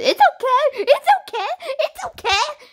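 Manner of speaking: very high squeaky mimicry; mocking imitation. Gamer imitating the rival's only comeback, high mocking voice. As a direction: parrot him in a high put-on voice. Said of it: "All he says".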